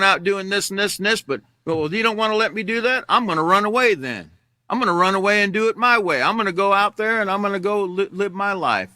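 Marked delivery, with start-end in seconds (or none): 1.6-8.9 higher-pitched mock teenage defiance; insistent, raised-pitch retold protest; defiant kid. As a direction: act out a headstrong child threatening to leave. Voices his defiant younger self.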